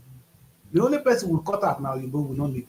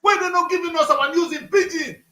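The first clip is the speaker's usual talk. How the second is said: heated rant; 6 semitones higher, 5 dB louder than usual. Real, not acted. Heated rant about news.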